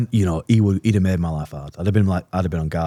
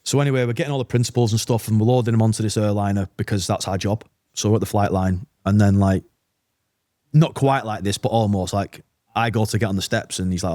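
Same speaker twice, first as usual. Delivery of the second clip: normal talking voice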